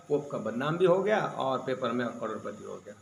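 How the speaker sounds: ordinary talk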